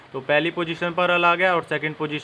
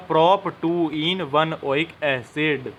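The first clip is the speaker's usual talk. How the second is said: slow dictation; much slower than usual. Shown as a demonstration. Teacher dictating final name.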